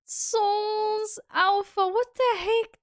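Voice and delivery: very high, giddy squeal; stretched, squealing delighted greeting; overwhelmed excitement. Real, not acted. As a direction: gush in high-pitched disbelief. Overwhelmed thanks to a viewer.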